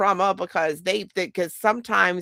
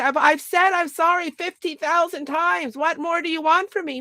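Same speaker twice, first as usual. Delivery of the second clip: higher pitched whiny complaint; mock exasperated whine; 8 semitones higher than usual. Mimicking someone fed up with apologizing, higher whiny.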